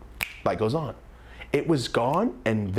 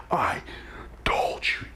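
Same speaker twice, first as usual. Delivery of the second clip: whispered; 2 semitones lower, slower than usual. Retold or imitated. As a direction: whisper it close to her face. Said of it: "I cuss in her mouth".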